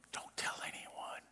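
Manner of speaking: barely audible mock-confidential whisper; hushed conspiratorial aside; secretive whisper.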